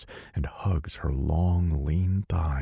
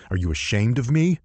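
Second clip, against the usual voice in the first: biting, louder challenge; snarl; 6 semitones higher, 4 dB louder, faster than usual. Acted. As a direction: snap the question at her. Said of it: "I snarl".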